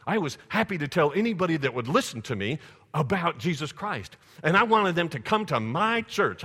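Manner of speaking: loud, high, rousing sermon voice; full-force preacher; fervent preaching. Acted. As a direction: preach it with fire to the congregation.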